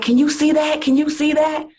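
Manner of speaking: high, loud, panicky repeated question; frantic friend. Retold or imitated. Friend at her own event panics over the changes, frantic high voice.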